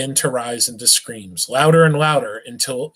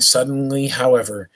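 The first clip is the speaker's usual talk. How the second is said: plain reading voice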